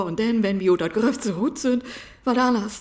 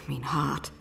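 much softer, breathy whisper; 7 semitones lower, 13 dB quieter than usual. Acted. Wife remembering her husband, whispered. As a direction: sigh it out barely audibly.

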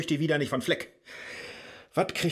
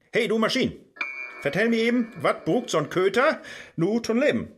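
loud, raised voice barking at the computer; shouting at machine; 8 semitones higher, 5 dB louder than usual. Acted. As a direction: yell orders at a dumb device. Shouting at voice assistant.

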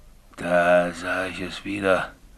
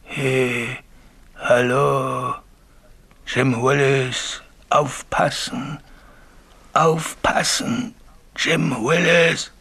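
slow, drawn-out calling, raised in pitch; distant ghostly call; 4 semitones higher, much slower than usual. Acted. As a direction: call out long and slow like a voice from afar. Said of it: "aus der Ferne hörte ich die gleiche Stimme". (langgezogener Geisterruf aus der Ferne)

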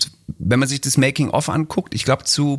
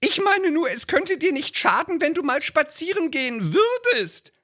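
hohe, drängende Ehefrauenstimme; nörgelnde Ehefrau; 13 semitones higher than usual. Acted. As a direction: den Mann antreiben. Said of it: "so ein Lieblingstext". (Ehefrau drängt ihren Mann, hohe Stimme)

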